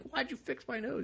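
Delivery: high and soft, baffled little voice; meek confused mock voice. Beaten inmate asks why his nose got fixed, high meek mock voice. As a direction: sound small and puzzled.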